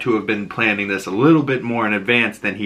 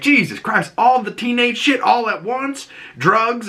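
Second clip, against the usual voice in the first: high mock exasperated list; exasperated dad; 13 semitones higher than usual. Joel scolding Ellie, high exasperated rant. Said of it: "he's like".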